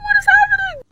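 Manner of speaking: very high, crying-out disbelief; shocked disbelief. Real, not acted. Substitute reacting to the screaming teacher, high shocked cry. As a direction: squeal it out in shock. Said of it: "shocked reaction".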